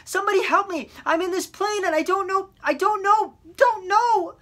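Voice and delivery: high, loud terrified screams; panicked screaming. A narrator's reading. Boy alone in the plane screaming into the mic in panic. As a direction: scream for help in total panic. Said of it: "he began to scream into the microphone".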